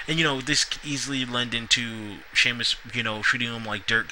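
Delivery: usual speaking voice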